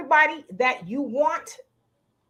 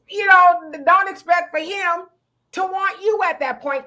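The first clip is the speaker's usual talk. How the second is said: normal talking voice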